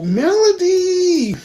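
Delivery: high, drawn-out happy call; excited greeting. Real, not acted. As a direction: sing out her name in delight. Greeting a viewer who joined.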